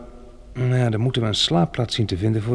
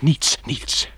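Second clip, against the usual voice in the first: hushed; 3 semitones higher than usual. Acted. Hushing the frightened companion.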